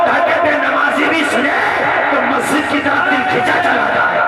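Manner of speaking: high, driving sermon delivery; impassioned preaching. Real, not acted. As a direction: preach it hard to the whole crowd. Preaches at full force.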